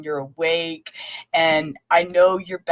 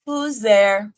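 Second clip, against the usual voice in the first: a bit louder, playful question; loud playful call; 4 semitones higher, 5 dB louder than usual. Pretend. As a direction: call it out like a joke. Playing knock-knock with herself.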